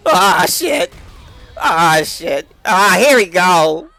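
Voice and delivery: loud exasperated cursing; frustrated yells. Real, not acted. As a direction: yell it out in frustration. Loud frustrated cries when grabbed.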